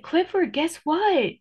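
playful build-up to gossip; excited call. Retold or imitated. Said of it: "I said".

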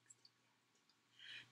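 hushed aside; whisper. A narrator's reading. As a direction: whisper it to the children. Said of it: "aside whispered to listeners".

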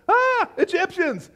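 high startled cry; mock panic. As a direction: gasp out a hammed-up alarm. Acting the Israelites' panic.

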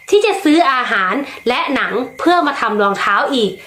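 plain reading voice

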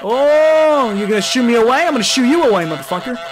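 high, loud mock-angry challenge; defiant yell. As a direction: shout it back in his face. Defiant goose confronts gardener.

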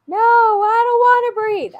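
high-pitched mock yell of a child; child's loud refusal. Overwhelmed child refuses teacher's breathing exercise, high loud protest. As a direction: imitate an overwhelmed kid loudly protesting.

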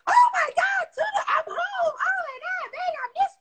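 squealing very high, gushing; sky-high gushing hello; overexcited homecoming. Retold or imitated. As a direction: greet the kids like a thrilled fan. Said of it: "you don't go to your children and say".

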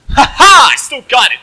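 high, very loud crowing; gleeful gamer victory yell; triumphant shout. Real, not acted. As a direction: laugh out loud and boast. Crows after a successful kill.

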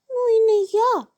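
high squeaky refusal; tiny mouse shrugging it off. A narrator's reading. Mouse refuses the rooster's request, high squeak.

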